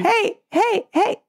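mock panicked calling. Retelling how she begged the driver, mock panicked. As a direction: call hey hey hey like a scared tourist chasing a car. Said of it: "Ficou como?".

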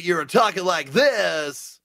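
slow, stretched radio DJ voice; exaggerated DJ demo; puking on the mic. Shown as a demonstration. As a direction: draw it out like a cheesy disc jockey. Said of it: "D J voice, they call it puking on the mic".

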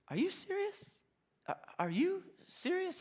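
stunned disbelief. Retold or imitated. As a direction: ask it in quiet disbelief, as if the question is absurd. Local stunned by the question.

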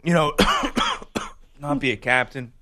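mock tearful athlete, halting with long pauses; half-hearted choked-up interview voice, breaking off mid-thought; fake choked-up crying. Host tries faking a tearful player interview, choked up. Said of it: "let's see if I can".

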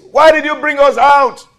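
loud, high cry of protest to Moses; calling out. Retold or imitated. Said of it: "They called out to Moses".

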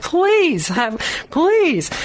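high, drawn-out mock pleading; pleading dread. Vendor seeing her come again, mock desperate plea. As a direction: beg in comic exasperation. Acts dread as woman returns.

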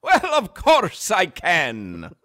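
slow, put-on Irish brogue; terrible Irish accent. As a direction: ham up a bad Irish accent. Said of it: "your terrible Irish accent".

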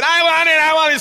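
high, loud mock tantrum scream; screaming kid. Mocking a kid in a grocery store, screaming. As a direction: imitate a screaming child demanding it. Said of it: "screaming, like".

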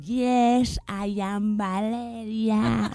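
high, long drawn-out mock English accent; inglés exageradísimo. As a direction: overdo the English accent, stretching every word slowly up high. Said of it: "yo todo exagerándolo mogollón". (acento inglés exageradísimo, agudo y arrastrado)

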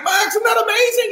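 high-pitched, overjoyed dad yell; giddy, screaming excitement; elated shouting. Retold or imitated. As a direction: shriek it in celebration with your son. Dad celebrating the shot.

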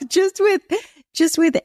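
words squeezed out high through a laugh. Real, not acted. Mother joking about a later pregnancy, laughing. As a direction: giggle through it, pitch shooting up. Laughs at her own remark on age.

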